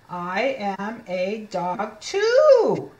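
very slow read-aloud with a long final word; slow model reading. Shown as a demonstration. Teacher reading second dog page, very slow word-by-word. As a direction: stretch out each word, landing big on too. Slow first take for children.

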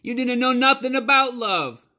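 very high, loud accusing yell; a grieving boy yelling at his father; furious shout. Acted. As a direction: scream the accusation in rage. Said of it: "Bryce shouted".

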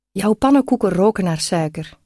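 plain reading voice

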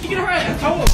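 loud, high hype taunt; hyped taunt. Acted. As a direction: egg on the fight. Same taunt repeated louder.

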